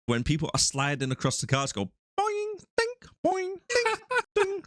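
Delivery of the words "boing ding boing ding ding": high-pitched cartoon boing imitation; spring noises. Mocking sliding-over-cars sound effects, high springy boings. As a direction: voice each bounce like a spring going off. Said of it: "The spring going off".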